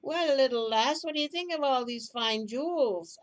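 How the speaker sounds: loud, rough-edged drinking man; loud drunken man. Acted. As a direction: call out like a rowdy drinker over the noise. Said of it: "talk in loud voices".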